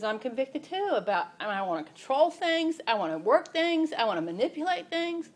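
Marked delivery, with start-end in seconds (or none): 1.5-5.3 teary. Real, not acted. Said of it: "that's probably the tears".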